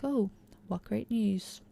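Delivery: slow, dry mock delight; sarcastic. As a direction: drag it out, clearly not pleased. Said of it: "very unimpressed at this news".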